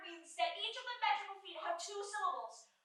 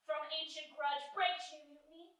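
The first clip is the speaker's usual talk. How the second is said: prologue recital; 3 semitones lower than usual. Acted. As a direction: recite it solemnly and unhurried.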